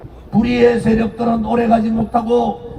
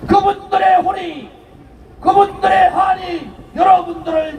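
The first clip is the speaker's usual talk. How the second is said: roaring rallying speech; 4 semitones higher than usual. Acted.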